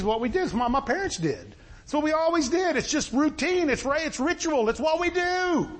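high-pitched mimicry of routine believers; mock churchgoer excuses.

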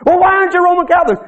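lightly voiced pushy woman; mock interrogator. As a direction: grill them.

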